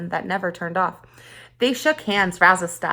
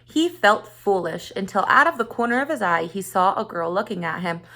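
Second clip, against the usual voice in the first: ordinary delivery, nothing marked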